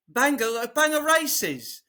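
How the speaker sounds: high, slowed English accent, R's dropped. Shown as a demonstration. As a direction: say it like an excited English fan of banger races. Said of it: "Banger in an English accent".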